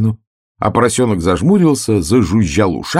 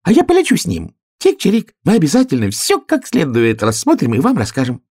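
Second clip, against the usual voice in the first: much higher, bright little bird voice; chirpy sparrow; 10 semitones higher, 3 dB louder than usual. Acted. Sparrow volunteering to fly along, chirping high.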